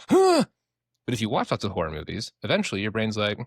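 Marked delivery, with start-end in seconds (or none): 0.1-0.4 startled gasp. Retold or imitated. Amygdala startled by scary noise.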